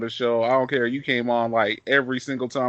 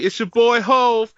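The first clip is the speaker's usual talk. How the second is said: Jay-Z impression; 9 semitones higher, 7 dB louder, slower than usual. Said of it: "then I'll be J C".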